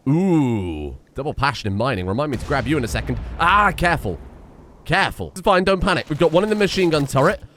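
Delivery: ordinary talk, nothing marked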